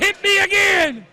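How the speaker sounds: ordinary delivery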